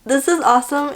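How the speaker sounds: high delighted gush; high excited this is awesome. Retold or imitated. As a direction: gush over the scene. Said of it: "I was like".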